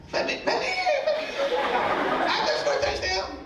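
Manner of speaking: very high squealed impression of an excited player; high-pitched player voice. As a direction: squeal it like a fired-up teammate in falsetto. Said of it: "[name] got his high-pitched voice".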